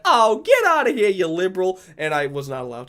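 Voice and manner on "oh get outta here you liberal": high, loud hammed-up dismissal; lady's mock outrage. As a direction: shoo them off in a high, put-on lady voice. Retells lady throwing the speaker out.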